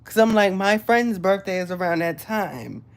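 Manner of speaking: ordinary talk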